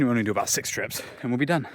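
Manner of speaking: usual speaking voice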